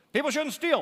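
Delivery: high, loud mock moralizing voice; sing-song scolding like a nagging parent; mock preachy scold. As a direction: wag a finger and scold the room. Quoting moral rules in preachy voice.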